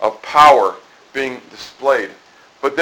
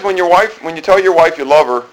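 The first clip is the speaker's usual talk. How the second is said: ordinary talk, nothing marked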